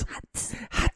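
very soft whispered word; breathy whisper. Pretend. Host trying out a topic title, breathy whisper. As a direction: whisper it like a teaser.

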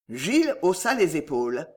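plain reading voice

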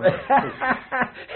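high, bitter burst of laughter. Acted. Painter laughs at his own ruin before the guests, bitter laugh. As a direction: laugh off the shame of eviction. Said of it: "Lach er toch niet mee".

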